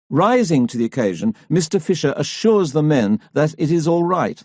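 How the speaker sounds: ordinary delivery, nothing marked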